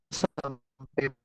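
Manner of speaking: normal talking voice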